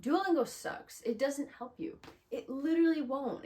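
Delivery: loud, high, emphatic verdict; blunt outburst. Real, not acted. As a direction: blurt it out after holding back. Blurts blunt opinion after hesitating.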